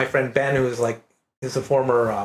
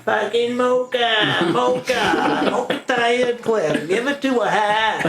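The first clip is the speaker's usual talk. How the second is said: mock Maine accent; 8 semitones higher, slower than usual. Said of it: "they'd be like".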